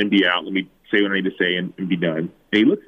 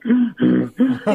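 high gasping mock sob; sharp, high-pitched imitation of sobbing breaths; imitated ugly crying; 13 semitones higher than usual. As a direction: act out an ugly-cry gasp. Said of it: "ugly, like".

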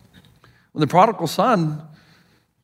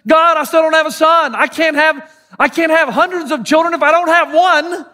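higher-pitched, exasperated protest; upset complaint to God; 9 semitones higher, 4 dB louder than usual. Acted. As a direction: plead your case to God, voice pitched up.

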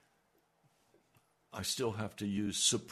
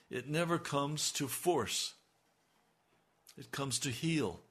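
usual speaking voice

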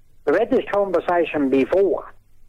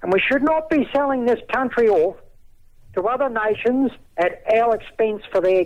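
raised, impassioned pitch; indignant rant; 3 semitones higher than usual. Real, not acted.

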